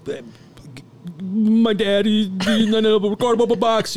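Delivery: high, loud and broken, fighting tears; losing it. Retold or imitated. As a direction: stammer it out high and loud, falling apart. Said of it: "I'm fucking losing it".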